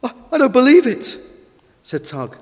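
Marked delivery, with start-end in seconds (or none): 0.0-1.2 high, stammered, loud disbelief; shocked disbelief. A narrator's reading. Tug has watched the boat sink, shocked and disbelieving. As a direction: protest in shock.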